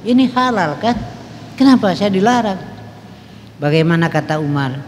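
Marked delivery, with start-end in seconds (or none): 0.0-2.6 protesting son. Retold or imitated.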